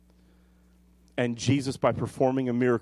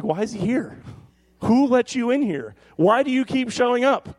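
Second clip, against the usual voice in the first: high-pitched, incredulous mock protest; put-upon, raised-voice questioning; exasperated complaint; 9 semitones higher, 4 dB louder than usual. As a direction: demand answers in hammed-up exasperation. Acting out exasperation at Jesus.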